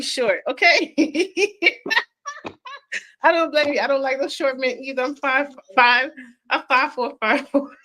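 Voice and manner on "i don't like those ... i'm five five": ordinary talk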